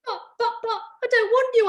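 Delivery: very high, whimpering protest; boy's high, tearful cry of protest; panicked boy. Acted. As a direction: squeak it out in panic. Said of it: "I shouted".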